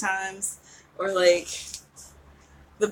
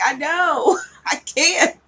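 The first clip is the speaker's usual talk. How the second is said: giggling, sheepish agreement; laughing admission; 7 semitones higher, 7 dB louder, slower than usual. Retold or imitated. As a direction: laugh it off, owning it. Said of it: "I was like".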